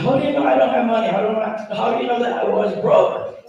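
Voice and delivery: high, fast, incredulous retort; quick high-pitched how-do-you-know; retold disbelief. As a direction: fire back in mock disbelief.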